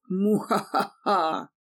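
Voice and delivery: slow hammed-up evil cackle; evil laugh. A narrator's reading. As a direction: cackle like a cartoon villain. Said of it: "снова зловеще захохотала".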